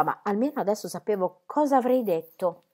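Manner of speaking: ordinary delivery, nothing marked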